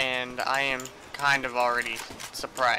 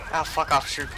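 ordinary delivery

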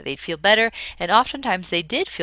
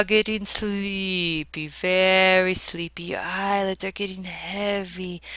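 slow, soothing mock hypnotist; 2 semitones higher, slower than usual. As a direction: lull them like a stage hypnotist. Mock hypnotist opening a lecture.

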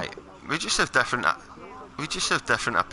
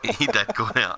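laughing; 4 semitones lower than usual. Real, not acted. Repeated answer, laughing through.

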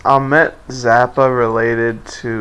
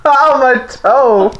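mock pained cry; 12 semitones higher, 4 dB louder than usual. Friend mocks the stubbed toe.

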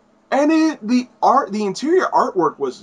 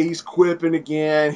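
ordinary talk

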